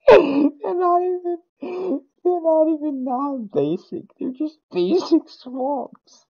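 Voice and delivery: high, slow words wheezed out through laughter; crying laughing. Real, not acted. To the others about the basic swamps, laughing helplessly. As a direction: laugh so hard it nearly turns to crying.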